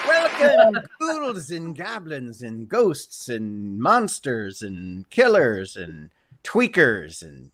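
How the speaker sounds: high, booming spooky host welcome; horror host. Acted. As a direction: greet the ghouls like a horror host. Host opens Halloween horror show.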